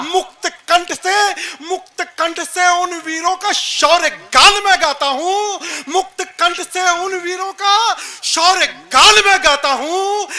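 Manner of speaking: high, ringing heroic declamation; rousing poetry recitation. Acted. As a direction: proclaim the verse loudly to a crowd. Recites patriotic verse on stage. (जोशीला, बुलंद वीर रस काव्य पाठ)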